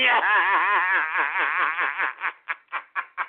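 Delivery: mocking belly laugh; gloating laughter; gleeful laugh. Real, not acted. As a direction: laugh at their defeat. Gloating after taunting.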